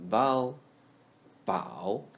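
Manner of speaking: bao with a dipping third tone; Mandarin third tone. Shown as a demonstration. Teacher drilling third tone bao for students. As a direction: model bao twice in the third tone. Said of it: "Bao is read as ... the third tone".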